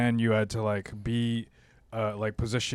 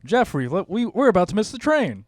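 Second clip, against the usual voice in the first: put-on old Hollywood accent; 9 semitones higher, 7 dB louder than usual. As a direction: ham up a classic Hollywood film accent. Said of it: "they gotta do the old Hollywood accent".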